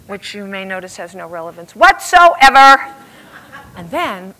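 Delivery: ordinary delivery, nothing marked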